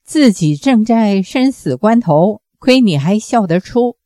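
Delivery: ordinary delivery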